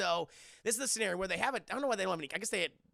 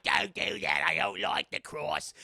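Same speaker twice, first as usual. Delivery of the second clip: low ghost plea; 3 semitones lower, 6 dB louder, slower than usual. Retold or imitated. Ghost Bill voice.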